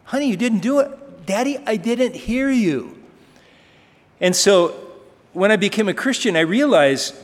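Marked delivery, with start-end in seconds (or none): 0.0-0.9 higher, patient pointing out; gently chide, as a patient dad; lightly voiced father. Father chides daughter again, higher gentle mock voice. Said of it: "And I go". 1.2-3.0 put-on higher, innocent little girl voice; pleading like a small child; little daughter voice. Preacher mimics his small daughter, in a high innocent voice. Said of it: "And I go".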